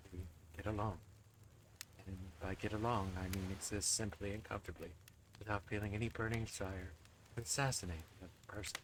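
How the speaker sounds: low, soft, standoffish killer; aloof spirit voice. Acted. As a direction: murmur it, pretending not to care. Spirit character voice.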